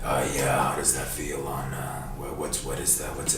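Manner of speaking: low, soft muffled creep; Buffalo Bill voice. Acted. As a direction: mumble low like a creepy killer. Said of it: "this is Buffalo Bill".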